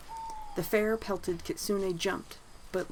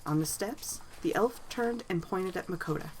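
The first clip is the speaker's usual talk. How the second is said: ordinary delivery, nothing marked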